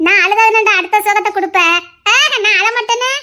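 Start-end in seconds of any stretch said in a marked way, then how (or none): 2.1-3.2 higher, giggly teasing; giggly mock denial; playful laughter. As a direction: laugh it off cheekily.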